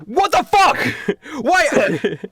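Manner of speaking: high, loud exasperated yell; hammed-up furious disbelief; outraged. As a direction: explode in a high shout. [name] outraged at being rearranged.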